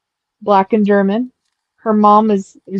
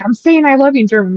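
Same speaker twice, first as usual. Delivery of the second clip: raised, quick mock yell; fast, higher-pitched retold outburst; 5 semitones higher, 4 dB louder, faster than usual. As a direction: imitate a kid insisting, higher and faster. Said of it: "he's, like, yelling it at my dad".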